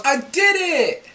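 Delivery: imitated little boy's high, drawn-out cheer; slow, high-pitched shout of victory; triumphant child. Dad imitates son freed from the shirt, triumphant. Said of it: "he pulls off his shirt and he goes".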